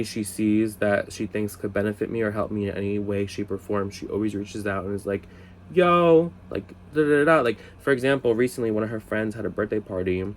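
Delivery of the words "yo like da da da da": high, loud "Yo" then da-da filler; mock coworker hail. Imitating his coworker calling him about a gig, high and loud. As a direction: call out like a friend texting news.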